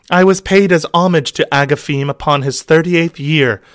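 high, breathy-free damsel voice. Acted.

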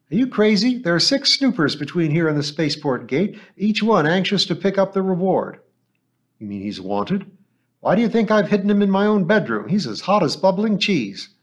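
put-on an incredulous old woman, scandalized; alarmed old woman. Mother Schaum, alarmed outburst.

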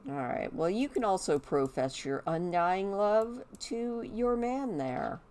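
ordinary talk

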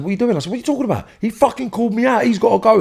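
high, heated insistence at his mates; retold furious protest. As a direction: confront them, outraged and set on it. Mates block the shooting, retold.

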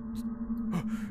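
sharp unvoiced gasp of surprise; startled gasp. Real, not acted. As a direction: gasp as if caught off guard. Startled by dad in game.

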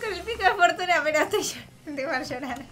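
high, whimpering laugh-sob; teary laughing lament; laugh-crying. Real, not acted. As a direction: talk through real half-tears. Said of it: "estoy al borde del llanto".

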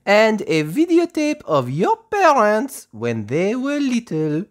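high, slow, fluttery French teacher voice; a gentle teacher speaking slowly to little children; Madame Gazelle voice. Acted. Teacher to her playgroup, high and slow.

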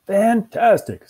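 loud, high, drawn-out enthusiasm; thrilled character exclamation; delighted. Acted. Dog delighted about alien food.

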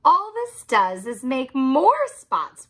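yelled. Acted. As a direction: scold the cat. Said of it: "we yelled at the cat".

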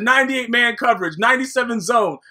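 ordinary delivery

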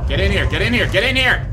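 loud, rising urgent yell; urgent shout. Real, not acted. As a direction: shout them into hiding. Urging partner to hide.

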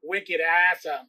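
put-on slow, drawn-out Boston accent. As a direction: stretch it like a Bostonian. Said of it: "Can you do a Boston accent with me?".

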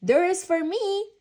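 high, very loud retort; cross child's loud comeback; defiant temper. A narrator's reading. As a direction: shout back stubbornly. Franklin in a temper, argues back.

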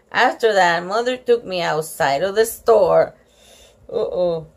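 ordinary delivery, nothing marked

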